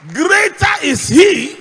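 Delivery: loud, high and slow, declaiming each phrase; full-force preaching. Acted. Pastor proclaiming to the church, loud, high and slow. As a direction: thunder it out to the congregation.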